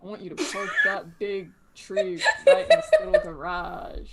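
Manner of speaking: song quote in a loud, hammed-up yeehaw accent; badly faked yeehaw twang, laughter breaking in. Player quoting a song terribly, yeehaw accent. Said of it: "was that your attempt at a, like, a yeehaw accent".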